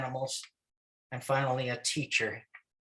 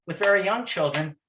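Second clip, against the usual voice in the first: normal talking voice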